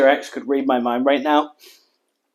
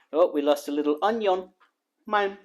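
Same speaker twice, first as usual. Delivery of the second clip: normal talking voice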